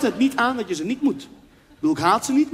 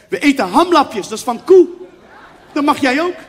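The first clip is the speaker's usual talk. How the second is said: mother-in-law; 4 semitones higher, 7 dB louder than usual. Acted. Mother explains the meat to her guest, imitated mother-in-law. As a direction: spell it out loudly for a foreign guest. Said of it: "Toen zei ze".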